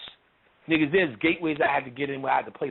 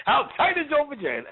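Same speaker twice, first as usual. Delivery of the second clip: pitched way up, teasing question; mock-eager asking; 10 semitones higher than usual. Spirit answering a self-declared child, high mock-eager. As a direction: ask it high with a big falling end. Spirit's blunt reply to a follower.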